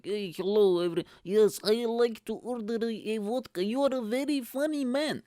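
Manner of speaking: high-pitched mock Eastern European accent. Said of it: "And she spoke like that".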